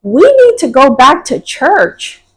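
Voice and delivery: high, slow, insistent mock yell; retold child's yell. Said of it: "she yelled and she's like".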